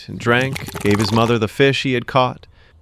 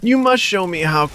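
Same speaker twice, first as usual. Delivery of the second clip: put-on innocent boy; 9 semitones higher than usual. Boy tricks her.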